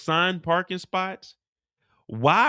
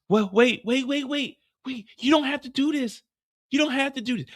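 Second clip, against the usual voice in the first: higher frightened wait-wait; higher half-hammed begging; mock pleading; 8 semitones higher than usual. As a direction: plead with the hitman. Mireya pleading.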